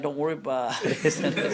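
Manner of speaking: slow, amused don't worry; laughing reassurance. Shown as a demonstration. Preacher explaining the hadith to the crowd, laughing reassurance. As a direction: say it with a chuckle, easy and slow. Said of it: "is like".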